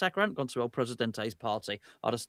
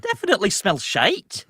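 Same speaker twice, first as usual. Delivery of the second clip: put-on louder, higher Scottish accent; 7 semitones higher, 8 dB louder than usual. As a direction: keep the broad Scottish accent. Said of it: "It needs a Scottish accent".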